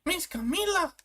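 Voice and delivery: very high put-on little voice; squeaky game character. Small character calling to Miss Camila, very high put-on voice. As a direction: call out in a tiny high voice.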